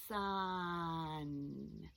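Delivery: SUN stretched out, each sound held; said slowly. Shown as a demonstration. Teacher sounding out a word for children, slowly. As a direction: draw out every sound in "Sun". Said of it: "Let's say it slowly".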